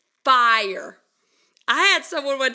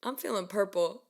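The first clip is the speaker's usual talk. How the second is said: deadpan serious; 3 semitones lower, 8 dB quieter than usual. Retold or imitated. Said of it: "serious as ever".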